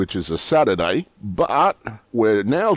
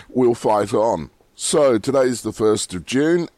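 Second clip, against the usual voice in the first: normal talking voice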